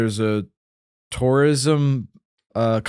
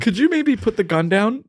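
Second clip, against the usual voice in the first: lightly acted timid request, higher pitch; tentative tourist; 6 semitones higher than usual. Tourist asks guide to put the gun down, tentative. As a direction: ask very carefully, voice lifted.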